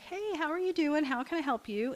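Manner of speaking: high, lively greeting; a cheery hello to a new customer; bright welcome. Retold or imitated. Cafe owner greeting a shy young man, brightly.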